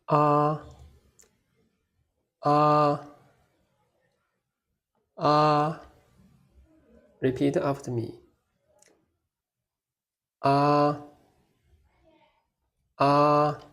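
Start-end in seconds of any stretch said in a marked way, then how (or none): 0.1-5.8 "Ah" sustained high and flat; high level tone. Shown as a demonstration. Teacher models the first tone for learners, "Ah" high and level. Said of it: "Stay high and level". 10.4-13.6 "Ah" sustained high and flat; high level tone. Shown as a demonstration. Teacher models the first tone for learners, "Ah" high and level. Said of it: "Stay high and level".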